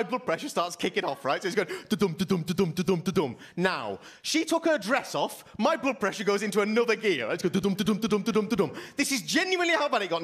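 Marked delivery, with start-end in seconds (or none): 1.9-3.4 rhythmic spoken "da-dum" heartbeat; imitated heartbeat. 7.5-8.7 rhythmic spoken "da-dum" heartbeat; imitated heartbeat. Heartbeat speeds up.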